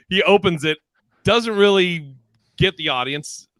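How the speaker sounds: usual speaking voice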